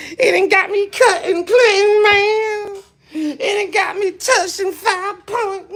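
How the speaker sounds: high, loud fake sobbing complaint; mock crying angry man. Mocking China Brown's anger for the viewers, high fake sobbing whine. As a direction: whine it like a broken tough guy close to tears. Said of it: "if you wonder why he's so angry".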